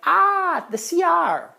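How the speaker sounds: high mock Filipino accent reply; Filipino accent imitation. Host pointing him to the bathroom, light Filipino accent. Hosts answer bathroom question.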